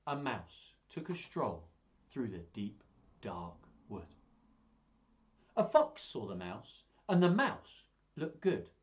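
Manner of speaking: put-on slow, lilting read-aloud to children; storybook narration. A narrator's reading. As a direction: tell it like bedtime for little ones.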